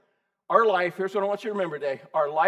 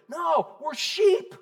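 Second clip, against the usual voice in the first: emphatic; 10 semitones higher, much slower than usual. Real, not acted. Preacher emphatic point.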